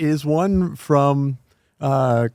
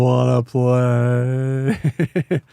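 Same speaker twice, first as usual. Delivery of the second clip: creepy doll voice; 4 semitones lower, much slower than usual. Retold or imitated. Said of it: "the voice eerily cuts back".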